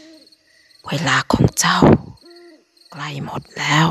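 a slow, menacing caller's voice over the line; low hoarse threat. Acted. Anonymous caller threatening the narrator, slow and menacing. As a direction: drag each word out like a creeping threat. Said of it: "ด้วยเสียงต่ำแหบพร่า".

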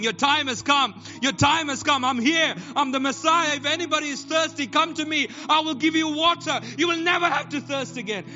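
high, loud preacher voicing Jesus; loud proclamation. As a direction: call out to a praying crowd as their Messiah. Voices Jesus calling to the crowd.

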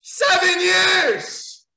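very high, slow, loud drawn-out yell; fed-up howl; exasperated shout. Real, not acted. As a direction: stretch it out in outrage. Ranting about repeated matches.

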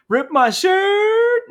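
very high, slow, cracking up; laughing squeal. Retold or imitated. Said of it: "I love that scene".

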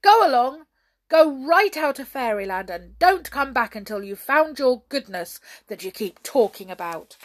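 loud, forceful banishing order; storming. A narrator's reading. King banishing the brownies, storming. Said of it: "stormed the king".

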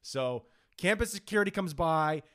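ordinary talk

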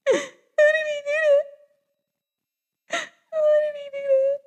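very high, squeaky crying voice; mock sobbing. Vlogger reliving heartbreak over a film scene, mock sobbing. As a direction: squeal it out as if weeping helplessly.

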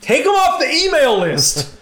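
high, loud mock yell; mock shout. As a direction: bark it like an annoyed fan. Yelling the emailer's demand.

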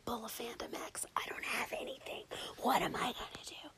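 whispered panicked inner monologue; inner thought whisper. Retold or imitated. Thinking to herself at the gift drawer, worried whisper. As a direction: breathe it like a frantic thought to yourself. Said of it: "I'm thinking to myself".

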